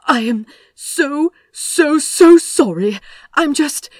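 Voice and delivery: high, loud, tumbling apology; flustered rush of words; breathless babbling. A narrator's reading. Aziraphale to the other driver, frantic breathless apology. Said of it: "The flood of words died, leaving him breathless".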